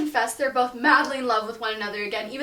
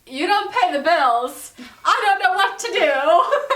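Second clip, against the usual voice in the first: high, loud, slow panicky wail half-laughing; panicked wail; 6 semitones higher, 8 dB louder, slower than usual. Retold or imitated. The carriage driver panics at the bride, in a high tearful wail. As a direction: ham up a frantic driver near tears.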